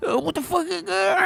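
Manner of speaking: hammed-up Rambo line; Rambo impression. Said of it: "I gotta do my Rambo accent".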